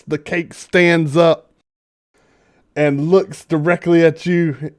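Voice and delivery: ordinary talk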